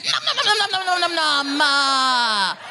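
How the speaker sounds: squeaky nom-nom eating sounds, sliding down; hammed-up happy chewing; mock munching noises. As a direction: gobble it up with silly noms. Gleefully eating sprouts.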